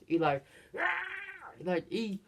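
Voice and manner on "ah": small imitation scream of despair; mock anguished cry. Hero who has failed, mock anguished cry. Hero's anguish after failing.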